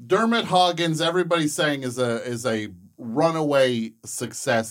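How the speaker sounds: hyped announcement. Acted.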